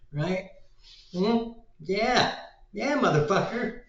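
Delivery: high, loud mock Boston heckling; hammed-up Boston accent in short, punchy bursts. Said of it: "what a Boston accent is".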